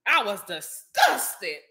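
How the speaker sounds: "disgusted" stretched out very slowly; emphatic disgust. Real, not acted. Vlogger repeating her reaction for viewers, slow stress on "disgusted". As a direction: draw out "disgusted" for effect.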